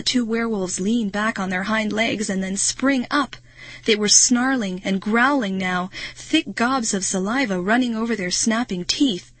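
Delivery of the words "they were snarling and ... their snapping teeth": ordinary delivery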